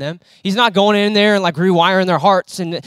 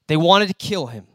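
usual speaking voice

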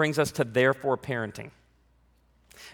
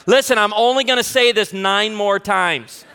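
exasperated parent; 4 semitones higher, 7 dB louder than usual. Retold or imitated. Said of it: "have to say things like".

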